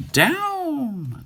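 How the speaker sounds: a high ringing call, held long; the witch calls her broom down to the ground; witch cries down. Acted. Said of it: "cried the witch".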